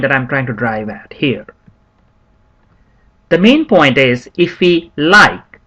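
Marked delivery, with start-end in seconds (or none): 4.4-5.6 "like" set apart and leaned on; slow the phrase and land firmly on "like"; stress on LIKE. Shown as a demonstration. Teacher driving the main point home to students, stress on "like". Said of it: "look at the emphasis on the word like".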